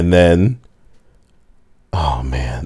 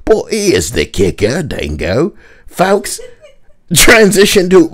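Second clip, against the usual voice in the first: lightly put-on Australian accent; 8 semitones higher than usual. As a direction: read it as a mock Australian. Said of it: "he's Australian now".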